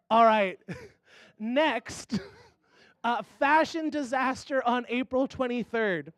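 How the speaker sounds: ordinary talk, nothing marked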